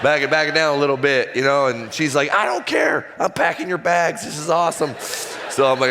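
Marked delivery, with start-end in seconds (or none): 2.3-4.9 much higher, shouted, thrilled squeal; excited screaming. Retold or imitated. Wife hearing of the trade, shouting high with delight. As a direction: imitate a wife yelling with joy. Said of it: "And she starts screaming".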